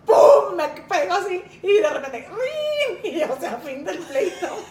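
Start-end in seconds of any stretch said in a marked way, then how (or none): 0.1-0.6 loud, high "pum" for the hit; sudden loud imitated thump; punch sound. Retelling the blow in the fight. 2.3-3.1 high, sung-out doorbell "ring"; high chiming "ring" with a laugh; doorbell sound. Retold or imitated. Doorbell ending the fight.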